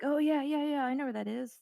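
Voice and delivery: confident eager driver voice; eager Uber driver. Retold or imitated. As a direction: mimic an overly sure driver. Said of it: "And he was like".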